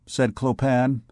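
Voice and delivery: plain reading voice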